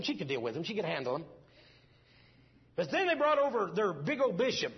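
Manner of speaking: normal talking voice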